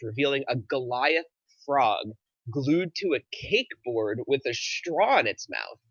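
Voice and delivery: ordinary talk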